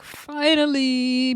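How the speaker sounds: high, slow, drawn-out finally; happy finally. Retold or imitated. Vlogger cheering that the new fighter finally arrives, drawn out. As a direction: stretch it out with relief. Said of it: "I'm just happy 'cause I'm like".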